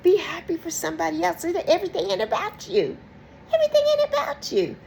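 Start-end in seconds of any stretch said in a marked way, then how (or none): 3.5-4.8 squeaky, fast, laughing mock voice; high mocking squeal with a laugh; mock whiny friend. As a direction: squeal it like a self-absorbed friend. Mocking a self-centred friend.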